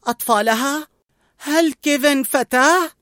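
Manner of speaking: put-on a boy caught off guard by the news; high and amazed, slowing down; surprised boy. A narrator's reading. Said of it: "تساءل راسل وهو متفاجئ".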